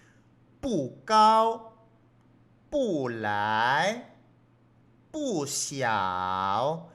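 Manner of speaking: 不 falling in fourth tone before 高, 来, 小; very slow, drawn-out 不高, 不来, 不小 with each tone fully shaped; tones as written. Shown as a demonstration. Teacher reading for students, slow unchanged tones on 不高, 不来, 不小. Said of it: "leyendo tal cual los tonos".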